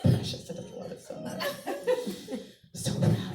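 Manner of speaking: very high cooing, breathless; mock doting. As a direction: gush like a proud mom.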